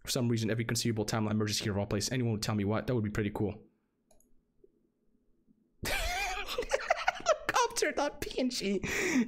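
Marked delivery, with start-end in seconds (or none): 5.8-9.3 high squealing laugh through the word. Real, not acted. Streamer cracking up at a helicopter picture.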